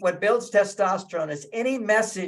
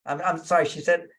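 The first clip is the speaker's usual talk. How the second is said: usual speaking voice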